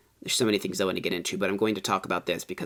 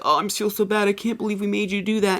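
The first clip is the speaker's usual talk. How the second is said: high, fake-sorry whine; mock their overdone apology; mocking ghost hunter; 11 semitones higher than usual. Mocking ghost hunters apologising to a screaming teammate, high whiny mimicry.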